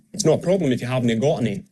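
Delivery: quick Scottish phrase with "havnae" for haven't; Scottish accent. Shown as a demonstration. Coach closing the dialect demonstration, quick Scottish accent. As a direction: run it together in a Scottish accent. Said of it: "a Scots speaker might say".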